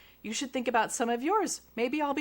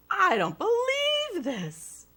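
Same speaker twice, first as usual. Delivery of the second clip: slow, high whining disbelief; hammed-up astonished judge; 11 semitones higher, 4 dB louder, slower than usual. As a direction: drag it out amazed.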